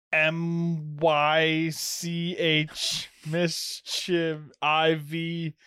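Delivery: spelling it out. Shown as a demonstration. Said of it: "spell the word mischievous".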